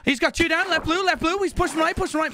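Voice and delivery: high, fast combat callouts; urgent callouts. Real, not acted. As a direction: call the enemy positions urgently. Live enemy callouts.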